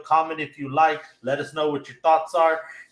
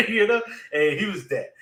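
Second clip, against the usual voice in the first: ordinary talk, nothing marked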